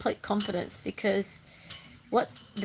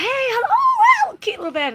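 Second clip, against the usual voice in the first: delighted greeting; 9 dB louder than usual. Retold or imitated. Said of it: "I was like".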